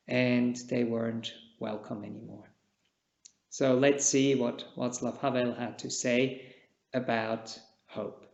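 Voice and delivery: usual speaking voice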